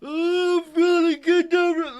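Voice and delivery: huge, loud, high-pitched fake yawn; mock yawning. Teacher demonstrating the killing curse in a loud mock yawn. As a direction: yawn right through the words. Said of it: "just finish your yawn before killing me".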